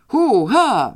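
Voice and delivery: high, loud wild whoop; wild yell. Acted. Father bursts out at his prized reducers, wild high yell. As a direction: whoop like a man gone mad with joy. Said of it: "wrzasnął dzikim głosem".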